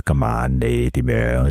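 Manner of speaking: low, slow and close, like a late-night host; intimate radio voice. Retold or imitated. Late-night DJ asking listeners, in a low, slow intimate voice. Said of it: "係好近密噉樣".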